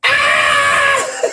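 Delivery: high piercing shriek of glee; delighted shriek. Real, not acted. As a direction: let out a loud squeal. Shrieks laughing.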